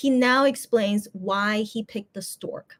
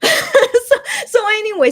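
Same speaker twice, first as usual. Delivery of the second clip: higher and louder, words through laughter; laughing; 10 semitones higher, 6 dB louder than usual. Real, not acted. Laughing at the name explanation.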